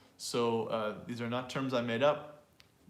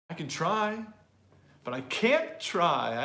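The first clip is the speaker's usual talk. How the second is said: "can't" loud and slow against a higher "I can try"; contrast can and can't; 4 semitones higher, 5 dB louder, slower than usual. Shown as a demonstration. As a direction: punch "can't" in the second half. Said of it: "we often stress negative".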